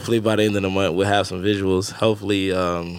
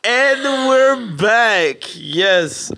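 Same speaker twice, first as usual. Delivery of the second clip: high, loud, stretched-out announcement; hyped show opener; 12 semitones higher, 5 dB louder, much slower than usual. Real, not acted. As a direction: kick off the show loud, drawing out the words. Opening the show after the song.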